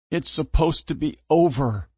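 high, slow tearful voice; crying. Acted. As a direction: speak through sobs. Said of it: "I said through gasps and hitches".

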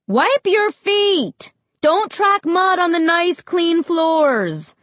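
high, drawn-out mom call. A narrator's reading. Mom to the kids coming in, calling out high and slow. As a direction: holler it slowly through the house. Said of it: "Mom called".

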